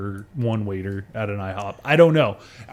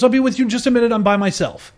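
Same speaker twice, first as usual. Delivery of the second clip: imitating waitress; 10 semitones higher, 5 dB louder, faster than usual. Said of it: "she goes".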